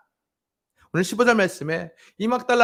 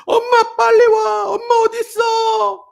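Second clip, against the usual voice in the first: much higher, louder, slow crying shout for mom; imitating a child's crying shout; 11 dB louder, slower than usual. Imitating the daughter shouting for mom during prayer, crying and loud. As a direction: wail it like a terrified child. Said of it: "엄마한테 소리 지르고 있는 게".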